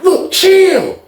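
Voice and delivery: high pleading voice; calm them down, pitched high; lady's voice. Retold or imitated. Lady telling the arguing men to chill, high voice.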